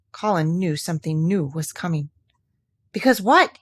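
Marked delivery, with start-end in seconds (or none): 2.9-3.6 louder, higher eager question; eager cry. Acted. Sick boy eagerly pressing Mary to go on. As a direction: press her eagerly for the rest. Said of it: "he cried eagerly".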